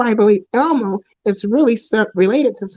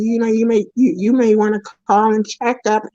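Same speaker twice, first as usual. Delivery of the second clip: normal talking voice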